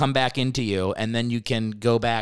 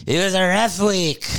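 high, hammed-up crying voice; mock tearful wail; 6 semitones higher, 3 dB louder than usual. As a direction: pretend to break down as you say it. Jokingly enacts the banned cry talk.

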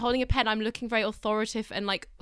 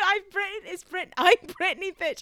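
sassy catchphrase; 7 semitones higher than usual. Acted. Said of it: "I got it wrong".

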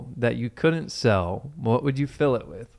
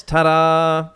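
triumphant ta-da; 4 semitones higher, 6 dB louder than usual. Acted. Flourish ending the ad.

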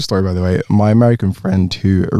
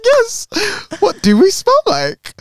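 laughing; slower than usual. Retold or imitated. Friends pressing her, laughing.

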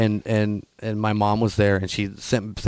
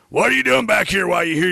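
gruff question; 8 semitones higher, faster than usual. Retold or imitated. Wrestler's retold gruff question.

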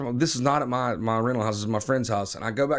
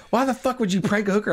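mock angry wife; 7 semitones higher, faster than usual. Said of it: "she was like".

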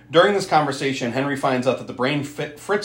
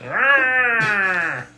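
long high imitated growl; monster growl; 8 semitones higher than usual. Monster lashing out at Fritz, long imitated growl. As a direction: let out a drawn-out mock growl. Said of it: "the growl".

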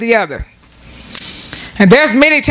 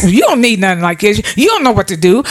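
ordinary delivery

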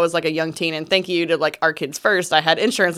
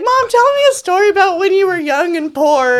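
put-on higher, laughing kid voice begging for a story; daughter voice; 12 semitones higher, 3 dB louder than usual. Daughter asking Mom for a story, higher laughing kid voice. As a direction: play the little girl, pitch up and giggle.

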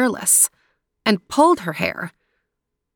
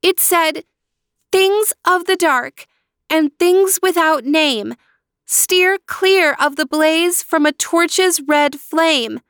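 put-on high girl voice repeating the rhyme, slower at the end; young sister reciting the owl's rhyme; girl quoting the owl; 9 semitones higher than usual. A narrator's reading. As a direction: recite the owl's words to your brother. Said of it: "said Natalia".

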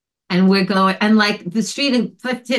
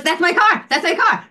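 high excited cry of recognition; imitating a friend spotting his lost car; 9 semitones higher than usual. Friend spotting his towed car, high and excited. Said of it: "he goes".

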